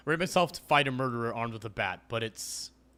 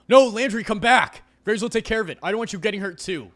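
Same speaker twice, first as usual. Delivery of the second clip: much louder and higher, pleading shout; calling out; 10 semitones higher, 12 dB louder than usual. Acted. Avery shouts after Landry running out the door. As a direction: yell after him to stop.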